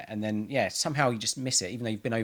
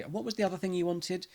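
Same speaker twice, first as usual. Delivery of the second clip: higher, softer sheepish phone question; 8 semitones higher, 4 dB quieter than usual. Retold or imitated. Ringing home from the shop, a higher, sheepish question. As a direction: ask sheepishly over the phone.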